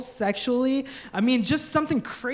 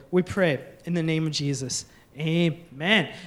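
prayer; 4 semitones lower than usual. Acted. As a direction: pray over the congregation gently. Pastor prays aloud.

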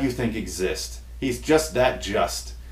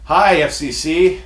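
slow, loud, higher mock greeting; radio mode; 4 semitones higher, 5 dB louder, slower than usual. Host greeting the watching FCC in pretend radio mode. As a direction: greet the censors with exaggerated care. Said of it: "go in radio mode right now".

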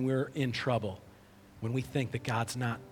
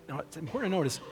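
ordinary talk, nothing marked

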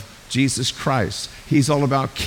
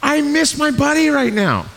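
higher, aching voice pining for a lost friend; hurting Paul; 8 semitones higher than usual. Retold or imitated. Paul, missing Barnabas, voices his lonely ache with sadness. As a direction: say it as if missing your best buddy. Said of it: "I think he's going".

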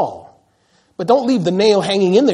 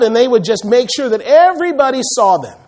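usual speaking voice

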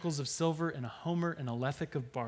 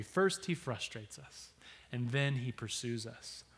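ordinary talk

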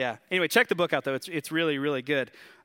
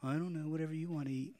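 meek, soft-spoken; 10 dB quieter, faster than usual. Acted. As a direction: play a pushover, very softly giving way. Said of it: "someone who's really soft-spoken and spineless".

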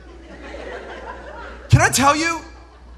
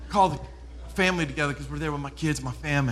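ordinary talk, nothing marked

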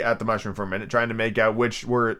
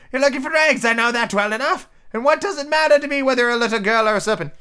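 very high, insistent bird voice; scornful pigeon; 12 semitones higher, 3 dB louder than usual. Acted. Pigeon calling Alice a serpent, high and scornful. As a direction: scold in a high squawk.

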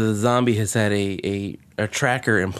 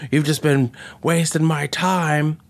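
mock exasperation; 5 semitones higher than usual. Acts out Matt Smith's exasperated line.

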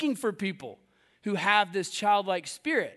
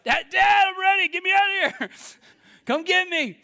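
put-on panicked child's call; 10 semitones higher, 6 dB louder than usual. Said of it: "Does the same thing Michael did in that treehouse".

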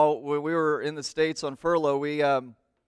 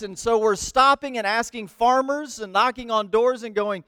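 ordinary talk

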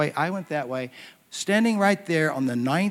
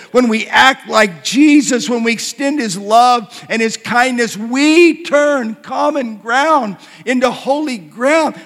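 higher, louder, rolling pulpit cadence; preaching at full force; 7 semitones higher, 8 dB louder than usual. Acted. Pastor rallying the congregation to advance the kingdom, full-force preaching. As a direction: preach it out with rising fervour.

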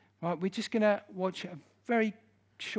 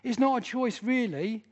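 protesting objector; 7 semitones higher, 6 dB louder than usual. Retold or imitated. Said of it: "people come up to me after me going".

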